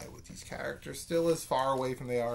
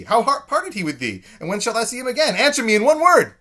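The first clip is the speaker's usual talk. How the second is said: rising, much higher and louder, faster and faster; frantic questions; 11 semitones higher, 12 dB louder than usual. Acted. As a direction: pour out question after question in a frenzy, building to a cry. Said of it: "my favorite line in the play".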